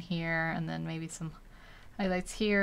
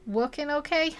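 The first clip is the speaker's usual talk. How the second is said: put-on "Working okay?" in an odd accent, higher and louder; exaggerated mock accent, pitched up; mimicked accent slip; 8 semitones higher, 5 dB louder than usual. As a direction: parrot an accidental accent in amused puzzlement. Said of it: "Some kind of accent thing".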